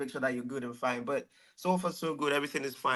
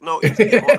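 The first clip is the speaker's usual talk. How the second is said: loud firm correction of Demola; 5 semitones higher, 11 dB louder than usual. Retold or imitated. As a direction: correct the name loudly and firmly. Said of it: "I'll be like".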